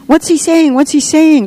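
put-on high-pitched eager children's voices; children crying out. A narrator's reading. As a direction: pitch it high like curious kids calling out. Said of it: "cried the children almost in unison".

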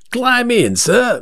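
high-pitched London driver touting for a fare; rival cabbie. Acted. Second driver invites the gentleman aboard, touting. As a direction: call the customer aboard in a high voice. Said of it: "he said".

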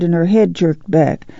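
plain reading voice

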